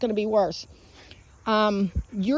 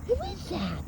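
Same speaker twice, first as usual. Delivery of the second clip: high, softer question; coaxing dog talk; 9 semitones higher, 7 dB quieter than usual. Real, not acted. Trainer asking dog about stranger, coaxing dog talk. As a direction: ask high and soft, as to a pet.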